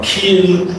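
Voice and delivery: "kill" drawn out with a long ee, like "keel"; wrong vowel demo. Shown as a demonstration. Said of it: "it's not kill".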